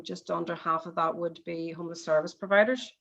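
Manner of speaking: usual speaking voice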